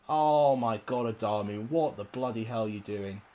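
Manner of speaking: ordinary talk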